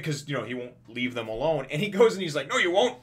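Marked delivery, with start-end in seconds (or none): 2.5-3.0 high, loud mock protest; defiant doctor. Doctor stopping the fleeing mother, high loud voice. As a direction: pitch it up and call it out.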